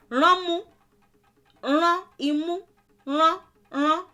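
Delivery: Ránmú said high with the nose pinched shut; squeezed-nose demo. Shown as a demonstration. Teacher demonstrating rán imú with a pinched nose. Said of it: "What I'm doing is rán, I'm squeezing my nose".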